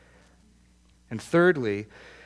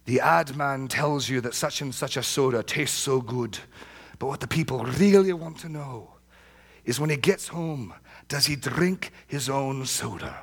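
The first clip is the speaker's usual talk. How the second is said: Eric Alexander; 3 semitones higher than usual. Retold or imitated. Preacher addressing a room full of pastors, thick Scottish accent. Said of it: "in his thick Scottish accent, he said".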